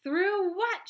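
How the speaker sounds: high, loud puzzled shout. A narrator's reading. Grandpa Joe shouting a question at Wonka. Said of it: "shouted Grandpa Joe".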